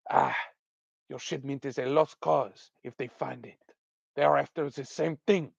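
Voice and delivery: terrible put-on French accent; mock French accent, dismissive; Aline. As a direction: play the artisan in a hammy French accent. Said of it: "give her a really terrible French accent".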